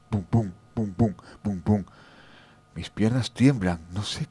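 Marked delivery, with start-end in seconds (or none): 0.1-1.8 imitated heart thumping; heartbeat. Scared narrator in the cemetery mimicking his heartbeat. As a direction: tap out the pounding heart with your voice. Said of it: "mi corazón me late deprisa".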